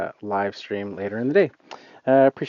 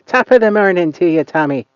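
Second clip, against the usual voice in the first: loud, higher hammed-up Irish greeting; greeting a viewer in Dublin, mock Irish accent; 5 semitones higher, 8 dB louder than usual. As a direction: belt it out in a mock Irish brogue. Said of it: "if that's a good Irish accent".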